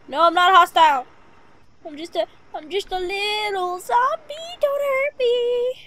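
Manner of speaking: high pleading little zombie; timid little zombie. Pretend. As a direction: beg like a harmless monster.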